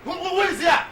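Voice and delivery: high, loud, stammering demand; alarmed challenge. Acted. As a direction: call out to whoever is lurking, repeating words. Calling out at unseen intruder.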